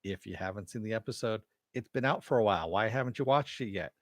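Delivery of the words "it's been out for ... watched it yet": normal talking voice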